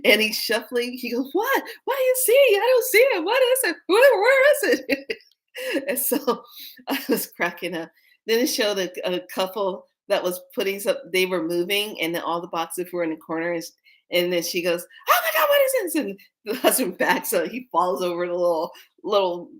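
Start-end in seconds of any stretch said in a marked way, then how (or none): 1.3-5.2 high, frantic squealed questions; mock panic. Shuffling husband searching for it, mock panic. Acts out panicking pranked man. 15.1-15.9 very high fake-alarm squeal; mock panic. Wife pranking husband while moving, mock panic.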